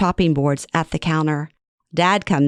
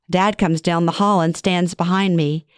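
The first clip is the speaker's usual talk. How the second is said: usual speaking voice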